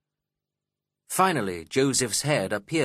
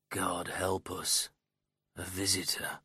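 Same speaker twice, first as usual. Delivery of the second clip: muttering to himself; 3 semitones lower, 8 dB quieter than usual. A narrator's reading. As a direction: mutter it quietly to yourself, dragging the words. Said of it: "he muttered to himself".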